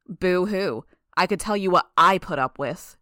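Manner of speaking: loud, scornful comeback; angry, dismissive mockery; mocking retort. A narrator's reading. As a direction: jeer at their complaint. Mocking reply in acted quarrel.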